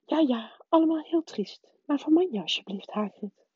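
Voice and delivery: high-pitched, hurried hushing; brisk, high professor trying to calm someone; whispering professor. A narrator's reading. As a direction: hurry the comfort along in a high voice. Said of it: "fluisterde professor Anderling".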